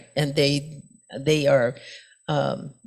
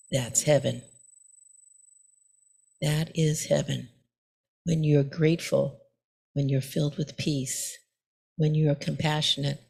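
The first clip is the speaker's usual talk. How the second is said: soft calm monk; 6 semitones lower, 7 dB quieter than usual. Acted. Monk tells the calmed samurai.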